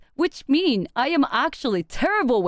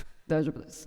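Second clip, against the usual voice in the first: much lower and softer, flat and grave; deep serious voice; 10 semitones lower, 9 dB quieter, much faster than usual. Retold or imitated. Speaker to her massage therapist, deep serious voice. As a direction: say it deadpan and low, staring them down. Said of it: "deep voice staring at them".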